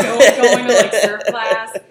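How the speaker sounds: can barely get it out for laughing. Real, not acted. Laughing at wetsuit surf class.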